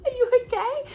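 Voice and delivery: very high, bubbly mum voice with laughter; happy ditzy mum. Retold or imitated. Imitating her ditzy mum arriving happy at the birth, very high and giggly. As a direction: squeal it like an excited, scatterbrained mum. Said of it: "She's all happy and ditzy".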